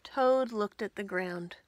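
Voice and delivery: ordinary delivery, nothing marked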